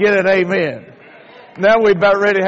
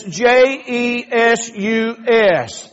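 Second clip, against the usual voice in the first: slow letter-by-letter J E S U S; spelled out slowly; 2 semitones higher, much slower than usual. Shown as a demonstration. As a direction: spell each letter deliberately. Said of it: "spelling it out".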